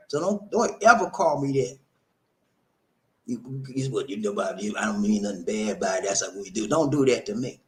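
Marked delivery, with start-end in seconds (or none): 3.3-6.7 low, soft, dismissive mumble; mock excuse. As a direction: shrug it off, a hint of a defensive friend. Voicing someone excusing the slur.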